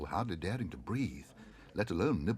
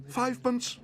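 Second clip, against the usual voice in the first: very high excited cry; 5 dB louder than usual. A narrator's reading. Bear eagerly bids on the silver stand. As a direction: call it out eagerly. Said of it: "cried Paddington excitedly".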